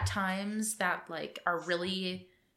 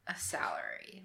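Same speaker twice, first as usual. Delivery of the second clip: unvoiced secretive whisper; 4 semitones lower, 9 dB quieter than usual. Real, not acted. Confiding aside about salary.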